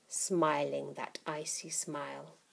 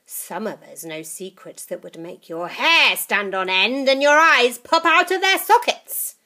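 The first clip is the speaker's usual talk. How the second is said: put-on menacing old witch; 4 semitones higher, 10 dB louder than usual. Grandma menacing.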